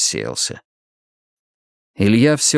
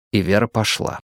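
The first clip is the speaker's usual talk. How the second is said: usual speaking voice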